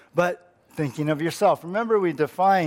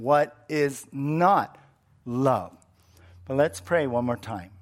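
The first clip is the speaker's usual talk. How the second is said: ordinary talk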